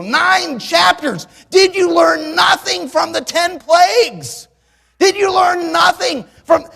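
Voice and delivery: high, loud, slow disbelieving shout; preacher scolding the Israelites in disbelief; exasperated rebuke. Retold or imitated. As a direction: scold them in outrage. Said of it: "You look at it and you say".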